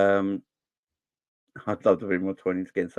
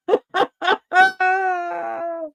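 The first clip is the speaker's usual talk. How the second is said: a high-pitched cackle of delight; high gleeful laugh; 4 dB louder than usual. Real, not acted. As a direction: burst into a mocking, high laugh. Laughing at United being bad.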